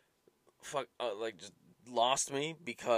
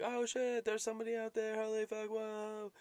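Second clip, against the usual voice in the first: much higher, excited gasp of discovery; kid's awe; 12 semitones higher than usual. Retold or imitated. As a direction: squeal like a kid spotting something spooky. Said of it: "And I'm like".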